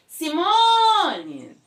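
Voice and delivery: long drawn-out calling shout; calling out a name. Shown as a demonstration. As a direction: call Simone as if from afar. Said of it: "vai falar assim".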